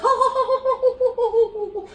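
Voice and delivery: high, loud mock laughter; crowd laughing. The whole bar laughing at the toast, high and loud. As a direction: imitate the room roaring. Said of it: "the whole place goes".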